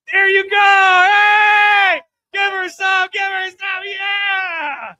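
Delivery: loud high shout to the audience; hyping the crowd. Real, not acted. Comic shouting at the crowd to cheer her on. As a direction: fire up the room with a yell.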